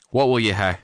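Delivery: a sudden roar, high and loud in a Scots dialect. A narrator's reading. The engineer roars at the intruder knocking, loud Scots bellow. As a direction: bellow it at the door like an angry Scot. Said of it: "he roared".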